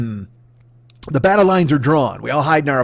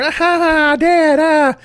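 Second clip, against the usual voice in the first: put-on high, shrill-free playful child squeal, much higher; squealing kid; 12 semitones higher, 4 dB louder, much slower than usual. Little boy squealing as his dad chases him, high and playful.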